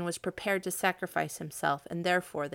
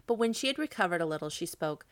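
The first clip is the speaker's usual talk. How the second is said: ordinary delivery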